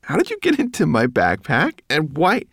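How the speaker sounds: high, loud astonished questioning; baffled. A narrator's reading. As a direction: demand an explanation, utterly puzzled. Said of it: "he added, baffled".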